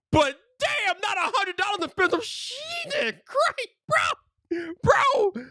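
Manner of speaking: high, drawn-out Jesus Christ; exasperated. Real, not acted. Telling viewers about the price, high exasperation. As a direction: cry out in disbelief. Exasperated at hundred-dollar haircut.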